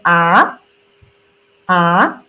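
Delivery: low "Ah" rising upward; rising second tone. Shown as a demonstration. Teacher demonstrating the second tone on "Ah". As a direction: lift the "Ah" from low to up. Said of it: "second tone goes up".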